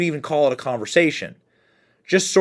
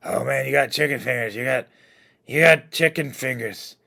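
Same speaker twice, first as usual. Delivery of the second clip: Joe Biden impression; 2 semitones lower, 4 dB quieter than usual. Replays Biden clip, mimics it.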